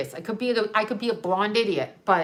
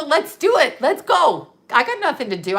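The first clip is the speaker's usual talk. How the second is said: defiant dare; 5 semitones higher, 5 dB louder than usual. Retold or imitated.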